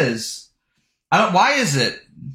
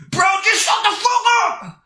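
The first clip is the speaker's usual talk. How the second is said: high, shrill furious scream. Real, not acted. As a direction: scream it at the top of your lungs. Screaming at commenter.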